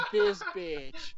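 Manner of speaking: high-pitched, breaking into laughter; laughing. Real, not acted. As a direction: crack up while saying it. Laughing at the teacher's question.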